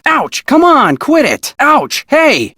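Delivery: high, yelped ouch and protest; pained protest. Acted. Being struck, yelping at the attacker to quit. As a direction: cry out and tell them to stop.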